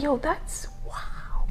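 hushed, slow amazement; awed whisper. Retold or imitated. Guest recalling hearing her manager switch speech, awed whisper. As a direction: let it drop to a stunned whisper. Retells being amazed by voice shift.

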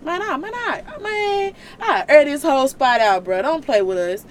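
loud, high, streetwise rant; God fuming, ready to air it out; indignant God. Retold or imitated. God ranting to Moses about the Israelites, loud and indignant. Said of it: "literally his conversations with Moses".

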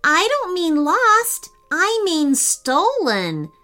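high, slow, emphatic insistence; insistent. A narrator's reading. Jacob corrects his brother about the sloth, insistent. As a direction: spell it out slowly like an insistent child. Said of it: "insisted Jacob".

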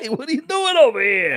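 hammed-up Brooklyn guy; Brooklyn accent. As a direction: call out in a mock Brooklyn accent. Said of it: "we use the Brooklyn accent for everyone".

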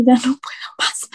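whispered, worn out; exhausted whisper. Retold or imitated. Agent worn down by yelling callers, whispered. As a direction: breathe it out defeated. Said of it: "llegué a un punto donde dije".

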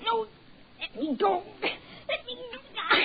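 high, slow, crying plea to be let go; wife begging her husband to let her go, crying; terrified pleading. Acted. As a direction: sob out the plea, high and drawn out. Wife pleads while being grabbed.

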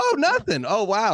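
high-pitched sarcastic wonder; mock amazement. Man mocks friend's story where nothing happened, mock amazement. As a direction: leap up high in feigned astonishment.

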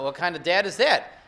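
ordinary delivery

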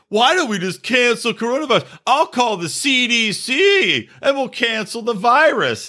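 mock her like a smug fiancé; mocking sarcasm. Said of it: "he's, like, mocking her".

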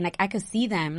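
ordinary talk

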